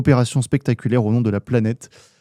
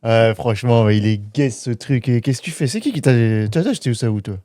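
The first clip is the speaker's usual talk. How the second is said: swaggering street guy; 3 semitones lower than usual. Acted. [name]'s put-on Tel Aviv persona.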